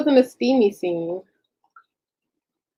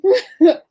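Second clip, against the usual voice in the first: embarrassed laugh; 11 semitones higher, 7 dB louder than usual. Real, not acted. Embarrassed laugh at own mistake.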